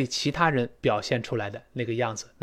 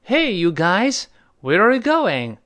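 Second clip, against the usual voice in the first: a loud, high hey you guys; calling out; 6 semitones higher, 6 dB louder, slower than usual. Shown as a demonstration. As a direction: call out to friends down the street. Example greeting called out. (大声招呼 hey you guys)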